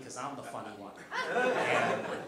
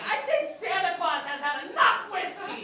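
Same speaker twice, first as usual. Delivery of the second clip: mom voice; 5 dB louder than usual. Retold or imitated. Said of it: "My mom would be like".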